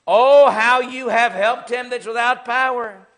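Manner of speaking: loud, drawn-out mock praise; sarcastic. Job mocking his useless helper, loud and sarcastic. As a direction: gush it slowly with exaggerated, sarcastic emphasis. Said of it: "there's sarcasm on Job's part".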